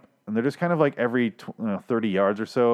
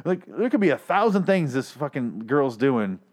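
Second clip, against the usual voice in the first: ordinary delivery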